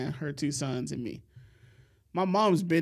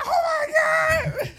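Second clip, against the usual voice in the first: put-on very high, loud girlish shriek; mock yell; 7 dB louder than usual. Retelling a girl startled by a squirrel, shrieked mock yell. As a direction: scream it out in imitation of a panicked kid. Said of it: "she starts yelling".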